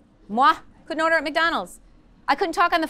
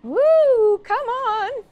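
high, loud cheer with a cry; cheering encouragement; 9 semitones higher, 4 dB louder, much slower than usual. Real, not acted. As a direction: whoop them up to the front. Cheering students up to stage.

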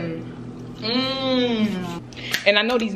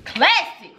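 normal talking voice

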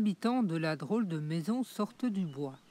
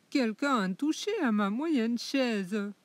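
higher, louder irritated mama bear; 4 semitones higher, 5 dB louder than usual. Acted. La maman ours fâchée pour sa chaise, irritée. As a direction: snap it out, annoyed. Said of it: "d'une voix irritée s'écrie".

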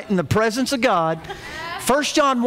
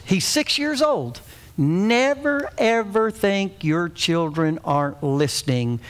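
usual speaking voice

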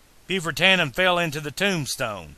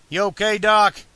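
raised-pitch check on a friend; higher-pitched youthful concern; concerned young hero; 6 semitones higher, 5 dB louder than usual. A narrator's reading. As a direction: ask with lifted, eager voice.

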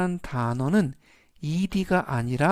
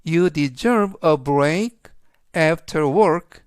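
very slow, louder, every word spelled out; slow model read; 5 dB louder, much slower than usual. Shown as a demonstration. Teacher reads the example sentence to students, slow and clear. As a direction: read the example slowly for learners to copy. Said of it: "그래서 전체적인 의미는".

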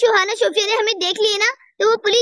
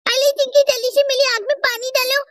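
ordinary delivery

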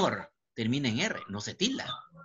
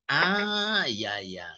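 dawning realization; much slower than usual. Acted. Teacher mimics a pupil who finally gets it, dawning realization. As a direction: act out the penny dropping. Acts out pupil suddenly understanding.

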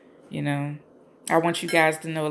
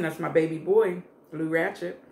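ordinary talk

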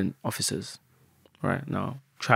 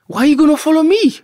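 way higher and louder, incredulous; exasperated yelp; 11 dB louder than usual. Retold or imitated. Asking the guys why they followed him, high incredulous yelp. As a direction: squeal it in disbelief.

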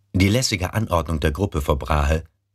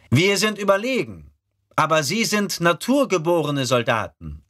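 higher, challenging reply; objecting recruit; 13 semitones higher than usual. Acted. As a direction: push back like a proud recruit. Rekrut widerspricht. (widersprechender Rekrut)

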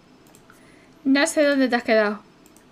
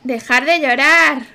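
slow, much louder shout; 3 semitones higher, 10 dB louder, slower than usual. Acted. Yelling at the crying baby Sim to stop, loud. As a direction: bellow the order to stop crying.

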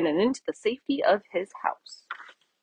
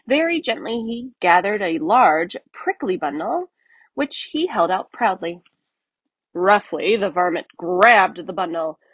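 ordinary delivery

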